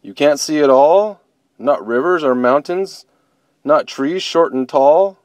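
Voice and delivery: disbelieving bear. Acted. Bobo asking blind bunny what she can't see, amazed. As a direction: let amazement grow with each question.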